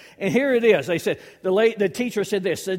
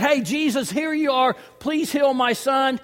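loud eager plea; 5 semitones higher, 4 dB louder than usual. Acted. Father's eager loud demand.

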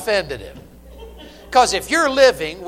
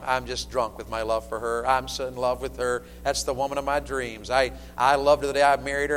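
soft, low declaration of love; hushed, heartfelt devotion; tender sincerity; 7 semitones lower, 6 dB quieter than usual. Real, not acted. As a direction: confide it quietly, meaning every word. Husband professing love for wife.

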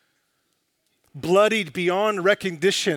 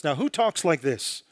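usual speaking voice

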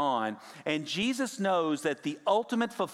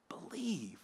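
awed whisper; 8 semitones lower, 13 dB quieter than usual. Acted. The crowd's astonishment.

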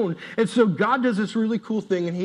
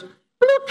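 high squeaky bloop sound; silly falling noise; mock bloop; 7 dB louder than usual. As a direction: pop the word out like a cartoon drop. Falling body sound effect.

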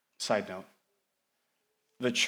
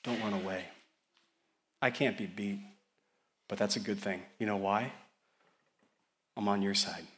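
gentle, softer voice of Jesus; reassuring; 3 semitones lower, 4 dB quieter than usual. Acted. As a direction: comfort the frightened listener. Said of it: "what he says is".

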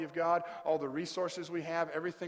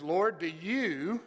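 YOU drawn out, swelling louder; stress on YOU; 3 semitones higher, 7 dB louder, much slower than usual. Shown as a demonstration. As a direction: lean long and loud on "you". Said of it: "There's two words I want you to look at".

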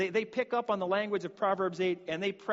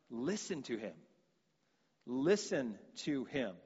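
solemn hush; 6 semitones lower, 8 dB quieter than usual. Acted. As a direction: hush it as a holy command. God's command, then repeated.

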